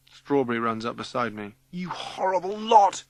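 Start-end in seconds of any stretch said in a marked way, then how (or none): 1.7-3.1 put-on high, slow, indignant child's cry; angry scream. A narrator's reading. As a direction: scold the mob in a pitched-up cry. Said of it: "she screams".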